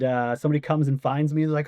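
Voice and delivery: usual speaking voice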